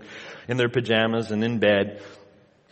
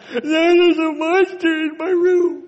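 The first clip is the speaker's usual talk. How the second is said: put-on high, slow whiny little boy voice; crying toddler; 6 dB louder, slower than usual. Father voicing his crying son about the monster. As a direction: wail it like a scared two-year-old.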